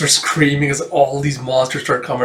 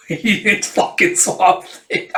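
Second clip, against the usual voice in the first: laughing; 7 semitones higher, slower than usual. Real, not acted.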